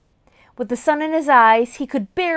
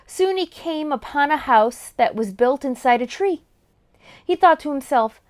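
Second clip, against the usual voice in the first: ordinary delivery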